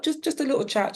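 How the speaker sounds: light reassurance. Retold or imitated. Mother reassuring her wary daughter.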